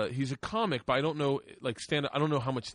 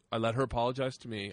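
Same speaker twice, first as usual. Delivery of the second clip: ordinary delivery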